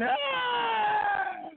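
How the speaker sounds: sudden high yelp of surprise; startled high shout. Real, not acted. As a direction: yelp in shock.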